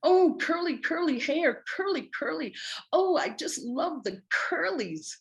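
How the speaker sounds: high-pitched, gushing mock squeal of delight; imitated gleeful shout. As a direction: coo loudly and excitedly, imitating a delighted admirer. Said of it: "shouting with glee".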